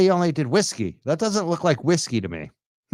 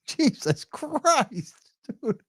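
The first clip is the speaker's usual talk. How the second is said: laughing disbelief; 9 semitones higher, 3 dB quieter, much slower than usual. Real, not acted. Laughing at winded speaker.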